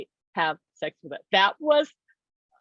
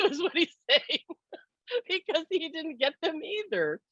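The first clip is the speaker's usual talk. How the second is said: high, laughing and trailing softer; 8 semitones higher, 4 dB quieter than usual. Real, not acted. Telling how both missed out on passion, laughing softly. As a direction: laugh it out as the voice fades. Laughing about shared loss.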